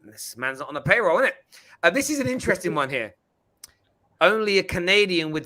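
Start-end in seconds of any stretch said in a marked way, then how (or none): none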